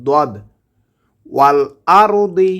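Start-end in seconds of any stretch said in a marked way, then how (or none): 0.1-0.3 দদ said from the tongue edge against the upper molars; letter dad demo. Shown as a demonstration. Teacher demonstrating the letter দদ to students. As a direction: sound দদ with the tongue side pressed to the back teeth. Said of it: "দদ হরফটিকে উচ্চারণ করতে হবে".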